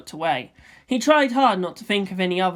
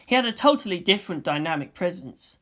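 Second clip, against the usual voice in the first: ordinary delivery, nothing marked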